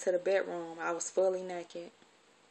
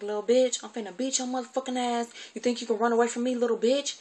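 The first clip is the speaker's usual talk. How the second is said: high, loud threatening yell, imitating the aunt; enraged threat; 5 semitones higher, 5 dB louder than usual. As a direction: spit the threat at the kid. Auntie threatening outside.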